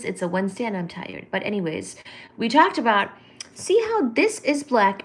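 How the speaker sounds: normal talking voice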